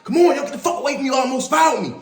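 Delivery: aggressive inmate. Retold or imitated. Inmate yelling at players who get too close, hostile. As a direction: shove people off with your voice.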